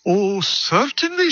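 put-on high, slow Irish lilt for the old woman; Irish accent. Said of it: "That's my Irish Indian accent".

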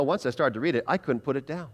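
usual speaking voice

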